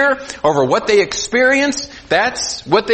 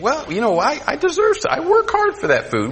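mock self-justifying; 8 semitones higher than usual. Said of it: "you think".

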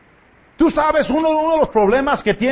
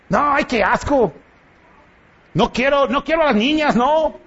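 mocking a boy; 4 semitones higher than usual. Mocking a boy who dislikes girls.

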